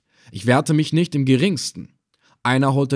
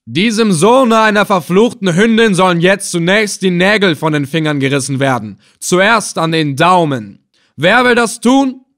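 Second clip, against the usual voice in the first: loud, raised-pitch villain's order; fierce public proclamation; calling out; 6 semitones higher, 8 dB louder than usual. A narrator's reading. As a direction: shout the cruel sentence to the crowd. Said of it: "hielt dieselbe empor und rief".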